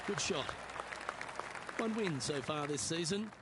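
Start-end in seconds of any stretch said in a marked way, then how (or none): none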